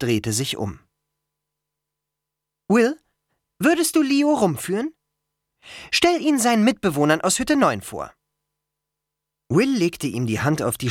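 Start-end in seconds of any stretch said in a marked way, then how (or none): 2.7-8.1 high, brisk-minded request; organizing Annabeth. A narrator's reading. Girl asks friend to show newcomer round, high and decisive. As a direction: politely take charge in a raised voice. Annabeth gives Will a task.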